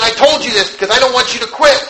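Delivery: high, insistent plea; urgent appeal. Retold or imitated. Woman urging the preacher not to quit, urgent appeal.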